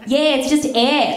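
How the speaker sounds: high, slow mock agreement; unbothered, drawn-out echo of the excuse; brazen agreement. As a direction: agree without hesitation, words stretched out. She agrees, then burps on purpose.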